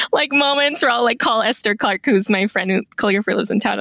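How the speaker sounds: ordinary delivery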